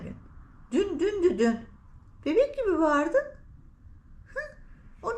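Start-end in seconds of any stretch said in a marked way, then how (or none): none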